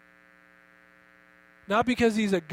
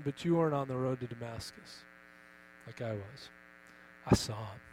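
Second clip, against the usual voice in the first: low, hushed earnest testimony as Paul; Paul testifying; 5 semitones lower, 4 dB quieter than usual. Retold or imitated. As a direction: lower and softer, as Paul recalling Damascus. Paul voiced answering his mockers.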